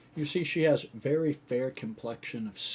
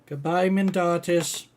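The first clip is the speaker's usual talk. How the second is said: put-on high, loud child voice; loud, high-pitched halfling girl; little girl's goodbye; 8 semitones higher, 11 dB louder than usual. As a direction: call goodbye like a small child. Little girl bids healer goodbye.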